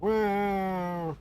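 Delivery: high, drawn-out mock meows; cat meow. Imitating the cat crying for the dog, high meows. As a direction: copy the cat's sad crying. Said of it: "and he's just like".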